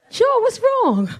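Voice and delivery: a hint of the son asking what's wrong; son's concerned voice. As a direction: play the son, a little worried. Son voiced in retold talk.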